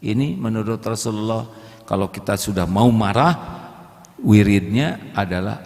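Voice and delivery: ordinary talk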